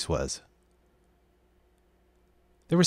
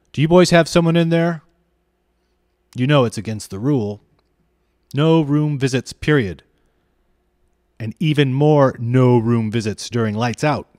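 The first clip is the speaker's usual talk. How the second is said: loud, higher, suspicious demands; stern scolding; 3 semitones higher, 6 dB louder than usual. A narrator's reading. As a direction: bang out the rules at the boys. Hall monitor scolds through door.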